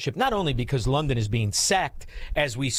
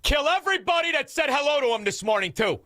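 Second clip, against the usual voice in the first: mock rant; 10 semitones higher than usual. Mocking the shooter's intent.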